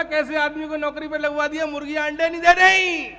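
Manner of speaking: fast, rising to a loud outburst; indignant complaint. Retold or imitated. Farm owner berating the brother over hens, indignant. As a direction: imitate an outraged boss, building to a shout.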